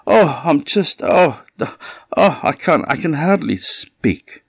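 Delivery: high, halting moan of a hard-done-by goat; milk the misery, faltering; feigned suffering. Acted.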